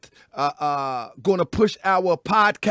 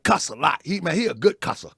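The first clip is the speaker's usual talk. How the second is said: ordinary talk, nothing marked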